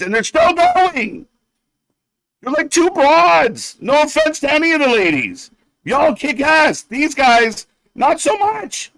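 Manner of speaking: fed-up commentator getting riled; exasperated rant. Real, not acted. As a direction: throw your hands up at them. Ranting at the wrestlers.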